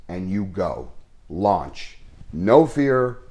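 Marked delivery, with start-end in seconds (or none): none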